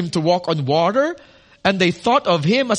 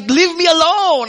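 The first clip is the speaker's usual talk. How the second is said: put-on higher, whiny like a hiding child; childish plea; 8 semitones higher, 4 dB louder than usual. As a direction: beg to be left alone, like a baby. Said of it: "and just, like".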